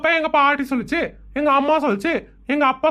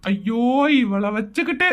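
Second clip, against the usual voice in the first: exasperated groan; 8 semitones lower, slower than usual. Acted. Husband exasperated by wife.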